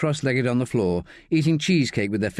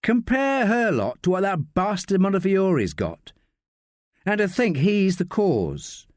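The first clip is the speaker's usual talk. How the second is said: higher-pitched woman, mildly indignant; Josie, indignant; 9 semitones higher than usual. Acted. Josie comparing Sally with Montefiori, mildly indignant. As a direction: protest in a higher character voice.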